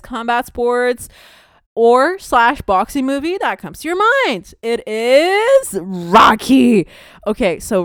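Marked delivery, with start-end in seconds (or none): 4.6-6.9 slow dramatic reveal. Real, not acted. Big reveal of favourite movie.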